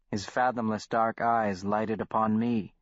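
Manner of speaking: ordinary delivery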